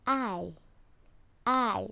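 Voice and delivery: I drawn out with the jaw dropped; long I sound. Shown as a demonstration. Teacher showing pupils the long I sound. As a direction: open wide on I. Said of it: "you will drop your jaw".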